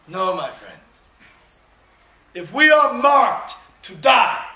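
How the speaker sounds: stirring theatrical recital of Henry the Fifth; rally the troops like a stage king; Shakespearean speech. Acted. Performs Henry's Crispin speech.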